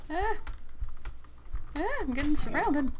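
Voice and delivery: high panicked yelps; scared shouting, surrounded. Real, not acted. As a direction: yelp as mobs close in.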